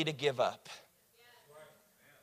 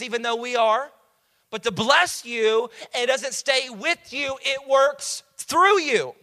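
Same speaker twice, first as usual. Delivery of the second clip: high, raised preacher's voice, key words stretched; emphatic preaching; 6 semitones higher, 4 dB louder than usual. Real, not acted. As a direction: drive each phrase home to the congregation.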